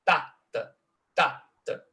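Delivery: "Tá, tá" with the first hit hard; strong-weak pairs. Shown as a demonstration. Lecturer demonstrating the trochee, strong "Tá" then weak "tá". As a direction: beat out "Tá, tá" as a trochee, twice. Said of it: "uma sílaba forte seguida de uma sílaba fraca".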